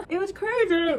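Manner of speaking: overwhelmed giggle breaking into tears; giddy fan laughing and crying at once; laughing through tears. Real, not acted. Laughing through tears after concert.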